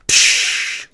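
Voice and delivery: sharp unvoiced crash noise "psh"; breaking glass hiss. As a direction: spit out a quick burst like glass shattering.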